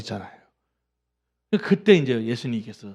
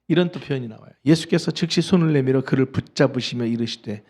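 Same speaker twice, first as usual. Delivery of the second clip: normal talking voice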